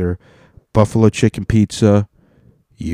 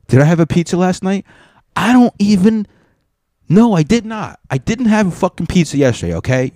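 much higher, louder indignant protest; defensive outburst; 11 semitones higher, 5 dB louder than usual. Real, not acted. Denying last night's pizza to viewers, indignant shout. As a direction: shout down the accusation.

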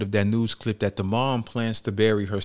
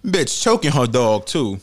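ordinary talk, nothing marked

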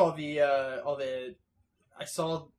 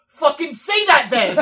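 high, loud burst breaking into laughter; laughing yell; 9 semitones higher, 10 dB louder than usual. Acted. Host reading out his favorite seagull meme, laughing yell. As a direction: yell the punchline through laughter.

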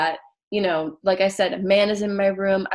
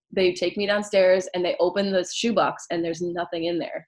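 ordinary talk

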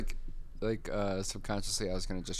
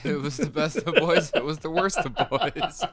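laughing; 8 semitones higher, 8 dB louder than usual. Acted. Laughing Dickens parody.

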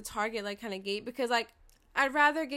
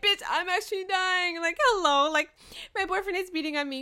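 loud, high, exasperated cry for help; mock outraged yelling; indignant mock plea; 7 semitones higher, 7 dB louder than usual. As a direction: play a desperate caller, pitched up and hammed-up. Acts out a stranger pleading for help.

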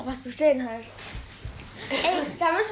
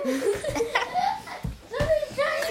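loud laughter; 14 semitones higher, 3 dB louder than usual. Real, not acted. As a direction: burst of high loud laughter. Laughing at own joke. (lautes Lachen)

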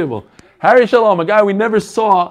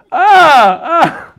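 a higher, loud startled "Ah"; mock startled gasp; 8 semitones higher, 6 dB louder than usual. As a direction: jump back as if caught off guard. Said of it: "he goes".

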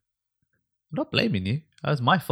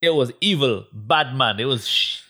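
louder, higher put-on street slang accent; 3 semitones higher, 3 dB louder than usual. Friends joking on a podcast, in a put-on street accent. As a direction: ham up a street accent to describe the film. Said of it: "I don't know what accent you're doing".

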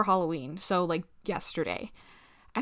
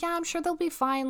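much higher, light carefree tone; breezy reassurance; 9 semitones higher than usual. Retold or imitated. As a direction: brush off the worry cheerfully. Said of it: "I was like".

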